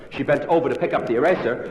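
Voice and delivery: normal talking voice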